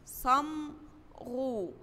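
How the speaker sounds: صمغو very slow and soft; model word. Shown as a demonstration. Teacher to first graders, soft drawn-out model word. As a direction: stretch صمغو gently. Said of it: "زي كلمة إيه؟".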